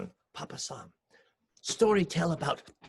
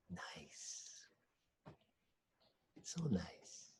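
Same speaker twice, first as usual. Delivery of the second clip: very soft unvoiced sigh of bliss; contented whisper; 11 semitones lower, 17 dB quieter, much slower than usual. A narrator's reading. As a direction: breathe it out drowsily. Enjoying rain falling asleep.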